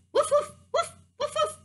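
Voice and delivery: high-pitched puppy woofs; dog barking. Pretend. Storyteller guessing animal noises for kids, high dog barks. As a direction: yap like a little dog.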